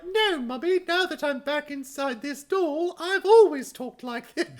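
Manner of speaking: very high, slow put-on doll voice; hammed-up little doll talking up high; doll character voice. Doll tells Mummy about its voice, mock high-pitched and drawn out. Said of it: "a similar thing happened".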